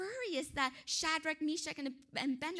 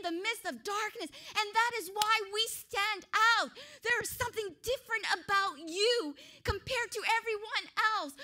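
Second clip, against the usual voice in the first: high, loud, fired-up preaching; carried away with enthusiasm; excited preaching; 5 semitones higher, 5 dB louder than usual. Real, not acted. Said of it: "I'm too excited".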